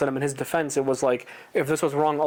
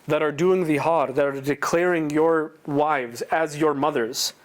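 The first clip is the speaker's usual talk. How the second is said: ordinary talk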